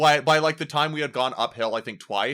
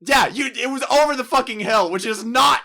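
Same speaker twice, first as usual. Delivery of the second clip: high, loud exasperated outburst; worked-up comic outrage; indignant rant; 10 semitones higher, 9 dB louder than usual. Real, not acted. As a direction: protest loudly at the top of your range. Indignant rant about the distance.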